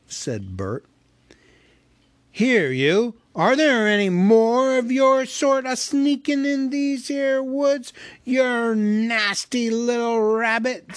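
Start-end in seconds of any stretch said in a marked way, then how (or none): 2.3-11.0 high, loud, slow troll growl; threatening troll. A narrator's reading. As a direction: menace the little rabbit. Troll shakes Bilbo.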